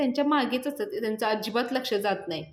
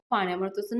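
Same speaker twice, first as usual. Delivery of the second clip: ordinary delivery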